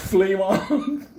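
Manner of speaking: loud laughing cry. Real, not acted. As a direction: crack up on the words. Laughing at fire.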